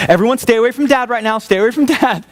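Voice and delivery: high, quick, hammed-up wife warning the kids; mock warning wife. Wife warns the kids to keep away from dad, high mock alarm. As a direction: play the wife herding kids away from a bomb. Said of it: "I can hear my wife going".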